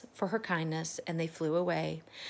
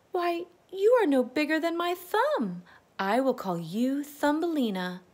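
high, amazed and loving; delighted surprise; 8 semitones higher, 4 dB louder than usual. Acted. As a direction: marvel at the tiny girl. Woman delighted by tiny girl.

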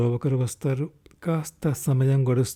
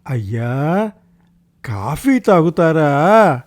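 slow, loud drawn-out call; servant's loud, stretched-out question; shouting into an ear; 3 semitones higher, 6 dB louder, slower than usual. A narrator's reading. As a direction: call out loudly as if to someone hard of hearing. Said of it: "గట్టిగా అరుస్తున్నాడు".

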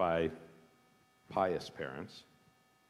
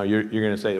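normal talking voice

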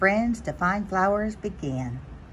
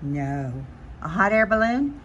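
plain reading voice

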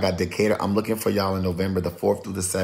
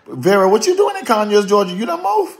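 high, loud surprised call-out; 12 semitones higher, 6 dB louder than usual. Real, not acted. To a viewer who moved to Georgia, surprised high call-out. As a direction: jumps way up in pitch, thrilled to see her.

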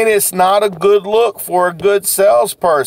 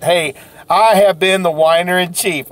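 ordinary talk, nothing marked

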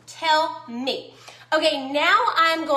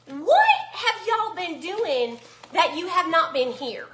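ordinary delivery

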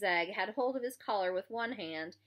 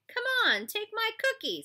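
high, loud goading; pestering bully, pitched way up; pushy taunting; 6 dB louder than usual. A narrator's reading. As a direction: needle him with a raised, insistent voice. Bully pestering with his cookie.

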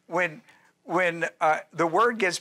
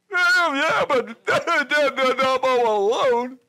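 high, loud, slow mock wail with a laugh; whining Elijah; 7 semitones higher, 5 dB louder, much slower than usual. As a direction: drag out the whine, laughing at him. Elijah's retort, comic.

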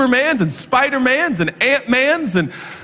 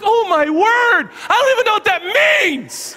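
mock exasperated shout; 11 semitones higher than usual. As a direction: throw up your hands at the know-it-all. Mock outrage at the cousin.

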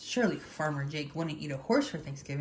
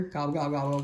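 low grumbled gobbles; grumbling; 3 semitones lower than usual. A narrator's reading. Turkey rejected by Rooster, grumbling low. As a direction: grumble lower, under your complaint. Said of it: "grumbled Turkey".

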